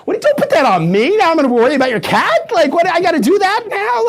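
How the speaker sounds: high-pitched, put-upon protest; mock exasperation. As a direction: complain in hammed-up disbelief at being burdened. Acting out irritated reaction to small talk.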